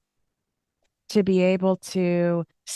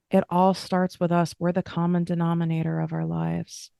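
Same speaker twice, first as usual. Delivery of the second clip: ordinary talk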